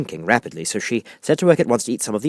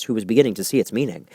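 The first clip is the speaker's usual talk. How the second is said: usual speaking voice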